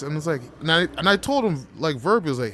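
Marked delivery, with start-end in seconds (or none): none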